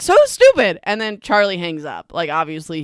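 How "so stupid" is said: loud, high-pitched burst of exasperation; exasperated outburst. Real, not acted. Exasperated reaction to the plot.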